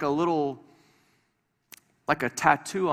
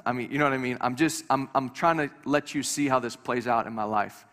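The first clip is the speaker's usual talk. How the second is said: ordinary talk, nothing marked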